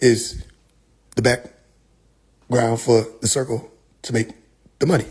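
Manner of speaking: low, halting mock lecture; imitated evasive preacher. Preacher dodging a money question with circles, low mock-profound halting. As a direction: stall solemnly in a low voice.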